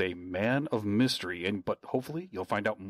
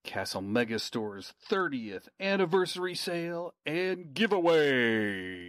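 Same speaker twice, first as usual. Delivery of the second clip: higher-pitched sale announcer, last word stretched; big promo read with a long GIVEAWAY; hype announcer; 4 semitones higher than usual. Pretend. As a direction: announce it like a prize show. Hyped ad announcer reading.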